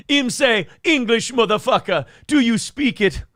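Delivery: high-pitched Jamaican patter; Jamaican accent. Acted. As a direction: put on a comic Jamaican accent. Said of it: "you should be from Jamaica".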